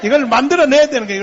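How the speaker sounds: loud, fast exhortation; shouted exhortation. Real, not acted. Preacher urging the congregation, loud and fast. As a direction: fire it at the crowd. Preacher repeats line three times.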